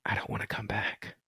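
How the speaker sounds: barely audible breathy whisper; faint retold whisper from a trance; dazed whisper. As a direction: murmur it as if half asleep in bliss. Answer from deep meditation.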